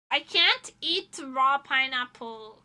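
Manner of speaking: ordinary talk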